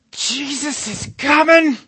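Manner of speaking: slow, very high building shout; excited shout. Acted. Bartimaeus, like Buddy, screams that Jesus is coming. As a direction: build to a yell of joy. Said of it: "blind Bartimaeus".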